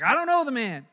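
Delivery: loud, high denial; panicked denial. Retold or imitated. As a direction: blurt it out to get them off you.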